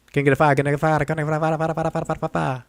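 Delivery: high fast auctioneer patter. Retold or imitated. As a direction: rattle it off like an auctioneer. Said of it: "car auction, auctioneers".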